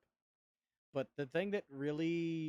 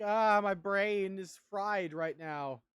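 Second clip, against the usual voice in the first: exasperated groan; 6 semitones higher, 8 dB louder, much slower than usual. Retold or imitated. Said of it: "and I'm just like".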